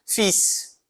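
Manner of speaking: "Fils" whispered, final S hissed; teacher whispers "Fils", S sounded; whispered demo. Shown as a demonstration. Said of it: "a consoante final".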